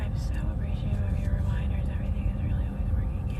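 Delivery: quick whispered reading; reading under breath. Real, not acted. Streamer reading a viewer's thanks to herself, quick whisper. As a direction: skim it aloud in a hushed whisper.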